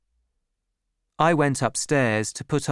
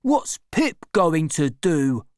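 slow, higher suspicious question; Orlick asking; 4 semitones higher, 3 dB louder, slower than usual. A narrator's reading. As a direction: probe with a sulky edge. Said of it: "asked Orlick".

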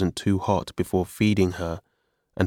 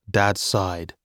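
ordinary delivery, nothing marked

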